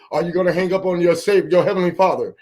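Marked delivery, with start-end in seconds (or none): none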